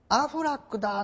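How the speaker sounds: put-on high-pitched little girl's voice. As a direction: imitate a small child calling out a discovery. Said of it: "女の子が".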